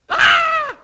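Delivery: very high, loud shriek; hammed-up pain yell; acted scream. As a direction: shriek like a whipped cartoon worker. Acted scream from whipped worker.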